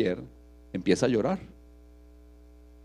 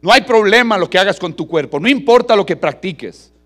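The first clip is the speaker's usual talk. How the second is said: higher and louder, brash reassurance; loud tempter; 7 semitones higher, 8 dB louder than usual. Acted. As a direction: sell the sin at full volume. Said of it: "y ellos le dicen". (el falso maestro prometiendo a gritos)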